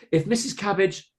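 high, loud urgent plea; pleading. A narrator's reading. Carolina trying to warn laughing children, pleading. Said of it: "Carolina pleaded".

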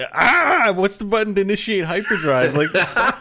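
high, loud, panicked searching for buttons; a flustered pilot who can't find the keys; frantic gamer panic. Retold or imitated. Gamer in VR panicking over which button to press.

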